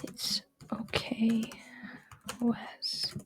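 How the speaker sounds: slow, soft near-whisper; gently calm him down, unhurried and hushed; soothing. A narrator's reading. Thomas soothing Wes, slow hushed reassurance. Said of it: "he soothed".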